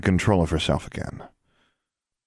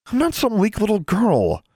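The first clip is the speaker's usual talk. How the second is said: high-pitched defiant protest; indignant; 4 dB louder than usual. A narrator's reading. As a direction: refuse to be seen as weak. Sister defends herself in acted quarrel.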